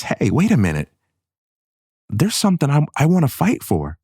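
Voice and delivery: daughter's inner voice. Retold or imitated. Voicing the upset inner alarm.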